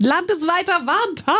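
high sing-out announcement; playful high-pitched proclamation; mock announcement. Announces warning day, mimicking sirens.

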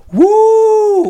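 high loud ride whoop; high, loud thrill yell; excited whoop. Retold or imitated. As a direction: whoop like on the drop.

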